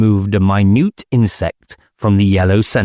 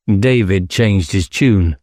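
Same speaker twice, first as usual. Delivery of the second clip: usual speaking voice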